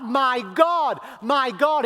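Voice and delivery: high, loud plea to God; crying out. Acted. Jesus calling on his God, crying out. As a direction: cry it out to heaven. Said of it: "And he cries it out".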